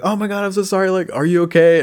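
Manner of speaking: high, fussy apology in a put-on girl's voice; mock panicked girl. Imitating the driver who hit him, high and worried. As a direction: squeak out a worried sorry. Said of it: "she's like".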